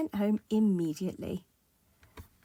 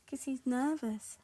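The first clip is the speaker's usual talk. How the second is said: ordinary delivery, nothing marked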